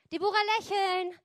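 loud, high command to perform; shouted order. Retold or imitated. Manager ordering the singer to smile, shouted command. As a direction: call it out sharply, high and loud.

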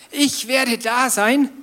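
high, slow, emphatic promise; God's promise. Retold or imitated. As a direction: say it slowly like God promising. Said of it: "Dann sagt Gott". (langsam und hoch: Gottes Versprechen)

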